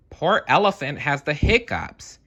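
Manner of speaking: chimp voice. Acted. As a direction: explain as an excited chimp. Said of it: "Chimp explained".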